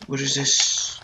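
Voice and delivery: ordinary talk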